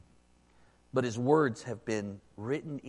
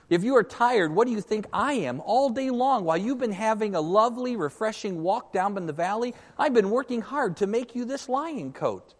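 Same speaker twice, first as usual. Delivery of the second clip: higher, complaining ape voice; whiny ape; 9 semitones higher, 8 dB louder than usual. Acted. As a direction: pile on the guilt, higher and higher. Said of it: "said Puzzle".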